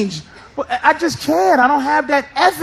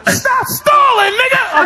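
mock gunman shout; 12 semitones higher, slower than usual. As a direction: bark it at the stalling hostage. Gunman yells at him.

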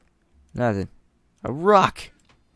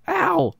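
high yelp of pain, played up; hammed-up ouch; mock pained yelp; 5 dB louder than usual. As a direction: yelp like you just got hit. Character hurt in game.

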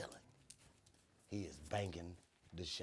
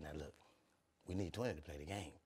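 quiet; 5 semitones lower, 14 dB quieter, faster than usual. Retold or imitated. Unc voiced explaining.

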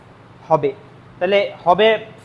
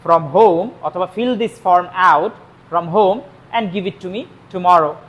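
slow dictation; much slower than usual. Shown as a demonstration. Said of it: "slow dictation to students".

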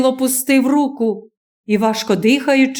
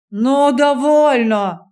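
ordinary delivery

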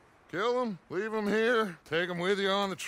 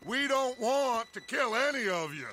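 ordinary talk

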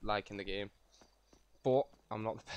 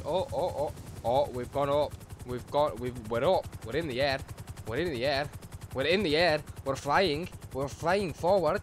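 louder and louder as excitement builds; thrilled, getting louder with each repeat; giddy excitement; 3 semitones higher, 6 dB louder than usual. Real, not acted. Helicopter finally lifts off.

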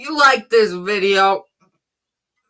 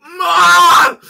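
calling out; 12 semitones higher, 10 dB louder than usual. Real, not acted. Calling out, mouth burning.

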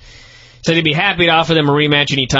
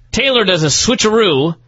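ordinary talk, nothing marked

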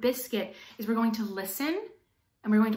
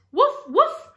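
high, loud little puppy woofs; dog barks; 13 semitones higher, 9 dB louder than usual. Acted. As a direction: bark it like an excited puppy. Dog barks in picture book.

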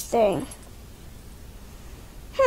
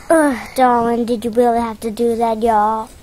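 put-on Applejack's accent; 7 semitones lower, faster than usual. Pony scolds darling in a put-on Southern accent. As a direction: try out a country twang, pitched low. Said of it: "Why do you have Applejack's accent?".

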